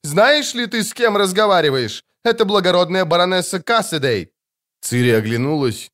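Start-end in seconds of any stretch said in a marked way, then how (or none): 0.0-4.3 high indignant cry; outraged. A narrator's reading. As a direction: announce the title in outrage. Matron outraged at robber.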